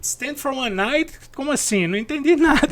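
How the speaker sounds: baffled high question; puzzled, rising disbelief; confused. Retold or imitated. Confused colleague hears wrong phrase.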